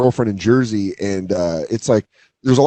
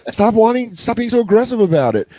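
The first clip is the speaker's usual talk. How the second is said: mock kid scolding; 8 semitones higher than usual.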